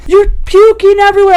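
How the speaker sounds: high, loud angry yell; retold yelling. Guy in the shower yelling at the puking woman, loud and high. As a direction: yell at her for puking everywhere. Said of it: "he starts yelling at me".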